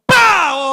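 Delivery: loud, high imitated blast "pa"; explosion bang. Telling the audience about the blast, a loud imitated bang. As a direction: burst out with a sudden explosion noise.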